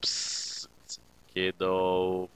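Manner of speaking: low, whispered beckon; mysterious voice. Acted. Ghost calling to the clone in the attic, whispered then low. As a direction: hiss it from the dark corner. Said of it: "A mysterious voice".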